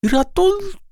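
very high, cut-off frightened squeak; a scream for help stuck in the throat; high startled cry breaking off; choked cry for help. A narrator's reading. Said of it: "wołanie o pomoc uwięzło jej w gardle".

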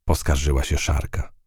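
ordinary delivery, nothing marked

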